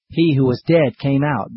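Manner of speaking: usual speaking voice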